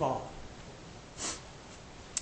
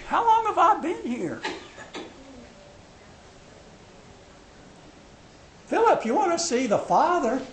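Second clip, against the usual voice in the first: much higher and louder, urgent and exasperated; Jesus pleading with the disciples; 9 semitones higher, 8 dB louder than usual. Acted. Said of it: "He says".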